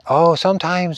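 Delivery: high, sing-song imitation of her; imitating the wife's high channelled voice; her high lilting voice. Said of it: "she talked like this".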